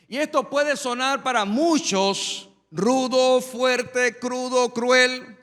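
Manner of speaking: forceful sermon delivery; emphatic preaching. Real, not acted. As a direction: preach it out to the congregation with weight on key words. Preaches on the passage.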